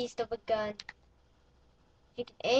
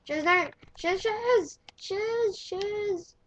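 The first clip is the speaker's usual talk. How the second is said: loud, high shouts of shiz; panicked cries at the screen; frantic yelling; 5 semitones higher, 5 dB louder, slower than usual. Real, not acted. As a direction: yell in real alarm. Frantic moment in the game.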